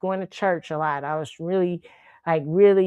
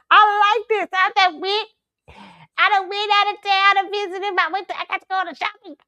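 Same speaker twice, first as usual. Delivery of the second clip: mock high voice; 11 semitones higher than usual. Playful put-on voice opening.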